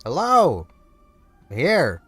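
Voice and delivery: usual speaking voice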